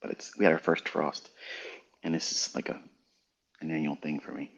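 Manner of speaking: sipping cocoa between words; soft, sipping a hot drink; while sipping a drink. Real, not acted. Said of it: "Pardon me if you hear me drinking my cocoa".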